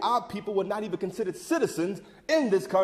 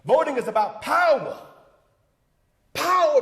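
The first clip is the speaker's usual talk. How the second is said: "power" punched louder, then repeated higher; stress on POWER; 4 semitones higher, 4 dB louder than usual. Acted. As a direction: hit POWER hard and let it ring. Orator drives home the key word.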